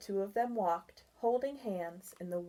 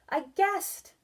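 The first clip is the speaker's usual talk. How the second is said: proud; 10 semitones higher, 4 dB louder than usual. A narrator's reading. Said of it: "Barry said proudly".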